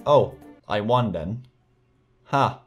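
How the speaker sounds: usual speaking voice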